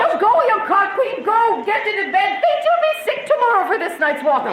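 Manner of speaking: high, laughing banter; laughing mockery of the master; teasing laugh. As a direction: tease him through laughter. Nurse teases Capulet laughing.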